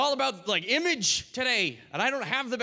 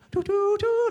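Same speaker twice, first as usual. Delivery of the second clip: high, tooted pan-flute notes; imitated pan flute; 12 semitones higher, 4 dB louder, slower than usual. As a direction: toot a little tune like a pan flute. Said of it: "little pan flute".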